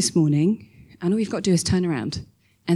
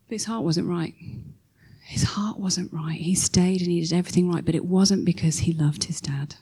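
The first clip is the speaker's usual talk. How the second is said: hushed whisper; 5 dB quieter than usual. Real, not acted. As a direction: drop to a whisper for weight. Preacher drops to whisper.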